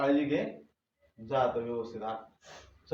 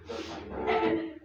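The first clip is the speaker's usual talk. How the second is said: gentle coaxing; 13 semitones higher, much slower than usual. Acted. Coaxing girl to drink.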